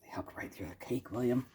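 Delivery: hushed unvoiced whisper. Whispering to William about the cake. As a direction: whisper it as a secret aside.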